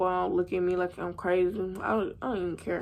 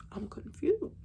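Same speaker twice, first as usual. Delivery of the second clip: breathy whisper, a little higher; 5 semitones higher, 3 dB quieter than usual. Retold or imitated. Retelling her shock to viewers, she drops to a whisper. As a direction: whisper it to yourself, dazed.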